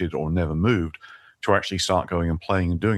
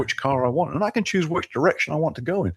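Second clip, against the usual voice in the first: excited, pitched well above usual; thrilled, high-pitched enthusiasm; creative excitement; 6 semitones higher than usual. Real, not acted. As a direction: let real excitement lift your voice high. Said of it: "hear the creative excitement in your voice".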